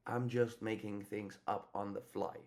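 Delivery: ordinary talk, nothing marked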